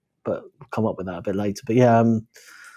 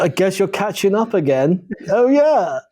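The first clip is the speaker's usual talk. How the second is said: mock posh voice; 9 semitones higher, 4 dB louder than usual. Voicing Mon Mothma's school friend.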